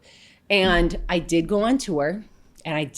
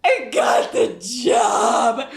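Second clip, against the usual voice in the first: drawn-out tearful shout, higher; screaming with joy; 5 semitones higher, 6 dB louder, much slower than usual. Retold or imitated. Telling her worried parents the news, tearful joyful shout. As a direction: cry it out slowly, overwhelmed. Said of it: "I was just screaming".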